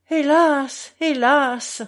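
wailing hélas; crying out. A narrator's reading. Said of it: "cria-t-il".

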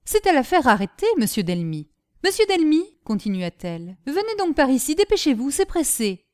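summoning him in a hurry; urgent calling. Acted. Madame Delmy calls her husband. (madame Delmy appelle son mari, pressée)

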